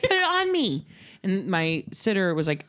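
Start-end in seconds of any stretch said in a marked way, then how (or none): 0.0-0.9 put-on high-pitched eager kid voice; demanding child. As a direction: imitate an impatient little kid. Excited child's demand, retold.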